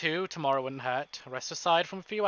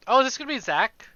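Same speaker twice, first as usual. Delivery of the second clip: eager guess; 8 semitones higher, 5 dB louder than usual. Real, not acted. Guessing who's at the door.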